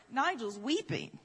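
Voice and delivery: ordinary talk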